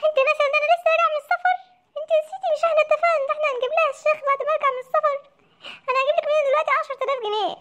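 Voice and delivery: fast, high panicked babble; frantic rapid protest. Acted. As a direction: rattle it off in a panic to his mother.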